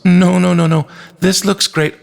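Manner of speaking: put-on high little-girl voice, insistent; hasty child protest. A narrator's reading. Bella turning down meal bars, high and hastily agreeable. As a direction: pitch it high and rush to reassure.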